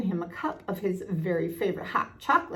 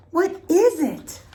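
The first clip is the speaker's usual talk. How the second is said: much higher and louder, dragging out what is it; high, loud, slow demand; 10 semitones higher, 8 dB louder, slower than usual. A narrator's reading. Piglet pressing Tigger a second time, high and loud. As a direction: ask again, anxious and insistent.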